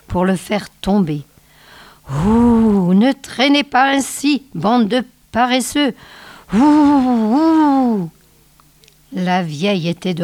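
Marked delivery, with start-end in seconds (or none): 2.3-8.1 high, eerie crone wailing at the wind; old snow witch. Acted. Old witch goading her wolves through the blizzard, high eerie howling. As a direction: howl it out like a cruel old witch urging the storm.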